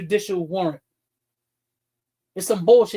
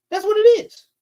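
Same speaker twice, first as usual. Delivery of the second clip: higher and louder, slammed down; heated final word; emphatic outburst; 11 semitones higher, 6 dB louder than usual. Real, not acted. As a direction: punch the conclusion hard. Rant punchline.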